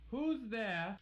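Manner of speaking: high, startled cry of fear; a girl alone in the woods cries out in fear; frightened scream. Acted. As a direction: call out scared into the woods. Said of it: "screamed Little Red".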